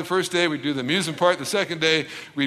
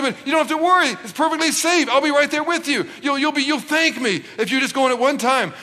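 coaxing reassurance; 7 semitones higher than usual. Acted. Dad coaxing frightened kids.

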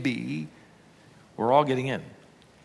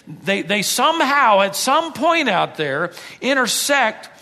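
preaching; 8 semitones higher, 5 dB louder, slower than usual. Acted. Preaching rises in force.

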